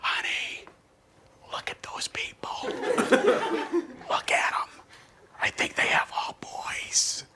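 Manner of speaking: hushed, nosy whisper behind their backs; gossiping whisper. Retold or imitated. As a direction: whisper like a busybody in the street. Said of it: "you hear people going".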